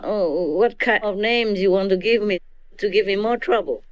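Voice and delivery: usual speaking voice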